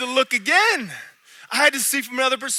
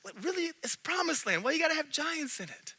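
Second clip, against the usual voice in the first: softer, higher mock complaint to God; whiny complaint; 6 semitones higher, 11 dB quieter than usual. Preacher retelling his complaint to God, softly whining. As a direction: lightly whine it at God, hushed and higher. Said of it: "I'm like".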